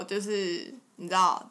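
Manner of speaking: ordinary delivery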